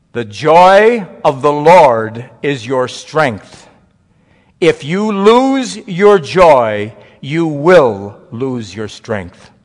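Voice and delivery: very slow, loud solemn proclamation; solemn pronouncement. Retold or imitated. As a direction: deliver the punchline slowly and with weight. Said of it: "And he sat down".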